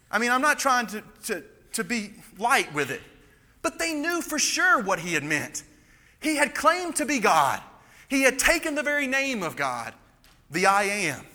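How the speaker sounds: high, insistent pulpit voice; impassioned preaching. Real, not acted. Preacher insisting that he had claimed to be God, impassioned preaching.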